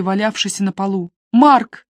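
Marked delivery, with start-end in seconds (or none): 1.3-1.9 loud, higher demanding yell; a sister demanding answers from her brother; shouting. A narrator's reading. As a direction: force him to answer.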